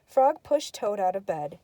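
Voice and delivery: plain reading voice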